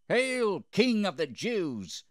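high, loud, drawn-out hail; mock hailing. A narrator's reading. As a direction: proclaim it in mocking salute. Soldiers mockingly hail Jesus.

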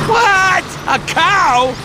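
a high startled what; surprised. Acted. Deadpool sees a cow.

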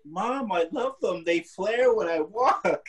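lightly voiced child gushing about boots; eager kid. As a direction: play your young self, delighted. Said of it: "I'm like".

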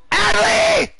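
screamed very high and loud, voice cracking; cracking shout. Real, not acted. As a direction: scream his name until the voice breaks. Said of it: "We're not gonna talk about the voice crack".

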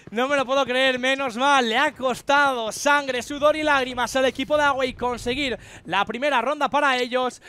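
loud, high celebrating caster; excited. Real, not acted. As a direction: cheer it out with relief. Co-caster celebrates first round.

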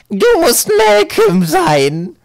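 very high, loud, drawn-out screech; cheese-grater crone voice. A narrator's reading. Mad old woman to Eddie in the coach, grating screech. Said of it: "mit einer Stimme wie eine Käsereibe".

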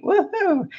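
very high whooped woohoo; excited cheer. Real, not acted. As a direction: let out a high little cheer. Cheers a far-away viewer.

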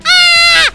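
high, loud shriek; terrified scream. Acted. Girl seeing her transformed sister, terrified shriek. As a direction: scream at a horrifying sight.

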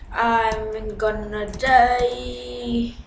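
very slow, stretched-out cry; drawn-out wail. Real, not acted. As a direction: stretch every word as you fall. Falling to death in game.

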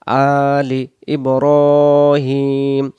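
very slow drawn Ibrahim; slow recitation. Shown as a demonstration. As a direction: chant slowly. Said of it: "লাম অক্ষর টান". (ধীর তিলাওয়াত)

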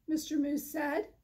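ordinary delivery, nothing marked

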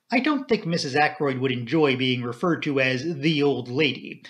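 plain reading voice

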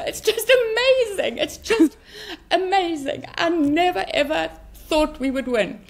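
choked-up, voice breaking with happy tears; fighting back tears of relief, pitch lifting; tearful with joy. Real, not acted. Guest telling her co-host about the citizenship ruling, tearful with joy. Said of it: "It's very emotional".

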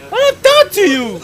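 high, loud mock wail; screaming to God. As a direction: shout up to heaven in mock despair. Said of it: "screaming to God".

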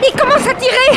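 high loud alarm; frightened shout. Acted. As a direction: yell the warning. Les Indiens tirent. (cri effrayé)

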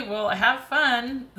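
high, loud, slow and laughing; laughing delight. Real, not acted. Reader wishing the listener fun in new love, laughing delight. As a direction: draw it out with a laugh. Amused, delighted at romantic cards.

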